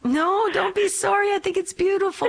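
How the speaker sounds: high, tender reassurance. Real, not acted. Host comforts tearful caller on air, tenderly reassuring.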